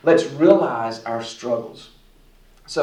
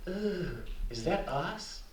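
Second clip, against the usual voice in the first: mock cringe; 3 semitones higher, 11 dB quieter, slower than usual. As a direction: play mild disgust under your breath. Acting out recoiling at own reflection.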